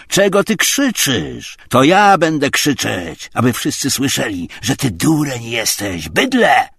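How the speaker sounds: loud, high, abusive yell. A narrator's reading. Tarantiew insults Obłomow for all to hear, yelling. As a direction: shout so everyone can hear. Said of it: "wrzeszczał Tarantiew".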